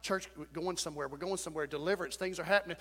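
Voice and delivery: soft, low murmuring; a quiet, low gossiping murmur; hushed, wary grumble. Retold or imitated. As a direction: murmur it behind someone's back. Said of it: "You're like".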